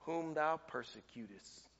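low, very soft, hushed divine voice; voice of the Lord. Acted. Preacher reading the Lord's answer to Saul, low and hushed. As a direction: speak it low and gentle from above. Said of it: "And the Lord said".

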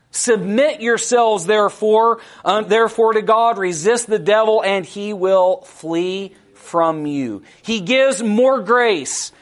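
loud, slow, ringing scripture preaching; preaching at full force. Acted. Preacher proclaiming James to the church, loud and slow. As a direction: lift the verse up slowly to the congregation.

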